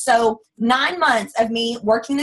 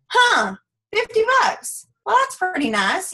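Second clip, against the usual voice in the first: pleasantly surprised; 9 semitones higher than usual. Retold or imitated. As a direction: sound caught off guard by the money. First paycheck of fifty dollars.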